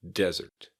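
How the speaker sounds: Desert said DEZ-ert; Desert with a Z sound and a weak second syllable; first-syllable stress. Shown as a demonstration. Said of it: "has an emphasis on the first syllable".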